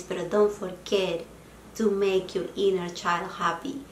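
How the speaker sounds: ordinary talk, nothing marked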